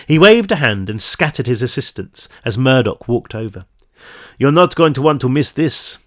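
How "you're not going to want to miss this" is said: lightly attempted Swedish accent. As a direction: try a slight Swedish accent. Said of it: "supposed to be like a Swedish accent".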